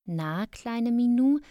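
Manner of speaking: high, drawn-out call to a kitten; coaxing. Acted. Child greeting a little cat in the dark, coaxing.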